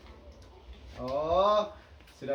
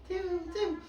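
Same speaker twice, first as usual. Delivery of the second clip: high squeaky cium; 12 semitones higher, 4 dB quieter than usual. Acted. Lagu anak, teriak cium.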